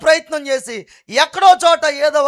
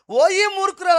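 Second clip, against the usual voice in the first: Job's rebuke; 9 semitones higher, 6 dB louder than usual. Retold or imitated. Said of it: "అనలేదు".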